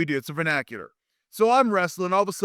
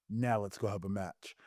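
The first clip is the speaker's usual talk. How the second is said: low, soft confidential instruction; hushed imitation of a whispered go-ahead; retold whisper; 6 semitones lower, 11 dB quieter than usual. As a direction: say it quietly, between two partners. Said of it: "he whispers".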